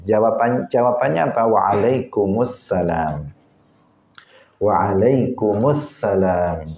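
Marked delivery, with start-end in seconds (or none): 1.4-6.8 slow, drawn-out Waalaikumsalam with clear al; slow careful "Waalaikumsalam"; correct reply. Shown as a demonstration. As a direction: stretch "Waalaikumsalam" slowly, every syllable clear. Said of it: "Itu jawabannya".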